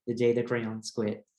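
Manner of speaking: plain reading voice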